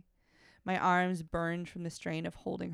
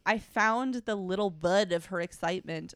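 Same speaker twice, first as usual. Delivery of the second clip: louder, annoyed reread; exasperated reread; 7 dB louder than usual. Real, not acted. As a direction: reread it with loud disdain. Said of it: "can we just call it a clip".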